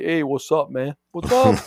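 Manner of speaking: hammed-up casual bro greeting; mocking dude lawyer. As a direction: play a lawyer who greets clients like buddies. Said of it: "just say".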